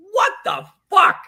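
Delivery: high, loud, drawn-out what the fuck; a stunned, squealing what the fuck; shocked outburst. Retold or imitated. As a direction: disbelief shrieked out slowly. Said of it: "and I was like".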